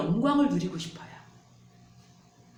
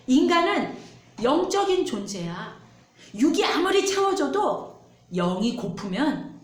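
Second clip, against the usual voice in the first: Jesus rebuking; 6 semitones higher, 4 dB louder than usual. Acted. Pastor voicing Jesus answering the devil, loud and rising. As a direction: proclaim it with mounting force. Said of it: "예수님이 말씀하세요".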